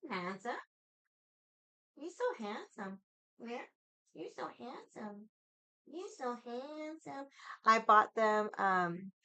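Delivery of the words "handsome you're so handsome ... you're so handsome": cooing to cat. Real, not acted. To her cat during the stream, soft high slow cooing. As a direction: coo it to a beloved pet.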